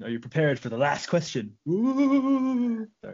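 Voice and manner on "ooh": drawn-out, high-pitched "ooh"; spooky, teasing build-up noise; mock-suspense ooh. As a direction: hold a high, playful ooh. Mock suspense before last question.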